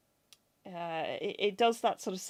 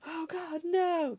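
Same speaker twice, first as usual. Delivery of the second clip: horrified; 10 semitones higher, much slower than usual. Retold or imitated. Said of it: "somebody just going".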